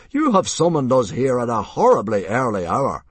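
loud, high indignant bluster; affronted, projecting protest; amazed outrage. A narrator's reading. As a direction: complain loudly in disbelief. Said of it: "said Mogadon in amazement".

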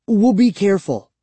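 insistent, high-pitched pledge; promising. A narrator's reading. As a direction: promise it quickly and earnestly. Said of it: "I promised".

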